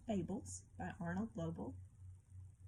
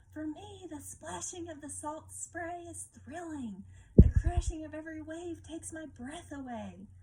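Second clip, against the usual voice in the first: thrilled lobster; 8 semitones higher, 6 dB louder than usual. A narrator's reading. Lobster telling the crab he loves the storm, high and thrilled. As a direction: savour the storm like a daredevil lobster. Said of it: "shouted Lobster above the roaring wind".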